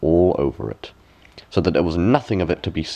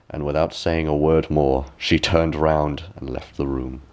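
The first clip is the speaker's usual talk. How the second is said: ordinary delivery, nothing marked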